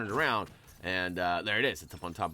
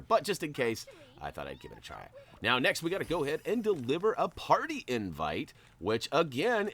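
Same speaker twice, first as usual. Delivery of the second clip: ordinary talk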